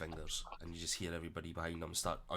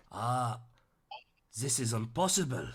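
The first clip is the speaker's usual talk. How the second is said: disbelief in a stray Welsh lilt, louder; Welsh-sounding accent meant as French; botched Welsh accent; 3 semitones higher, 5 dB louder than usual. Acted. Figure astonished to see a familiar foe, Welsh accent. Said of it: "I don't know why he turned Welsh there".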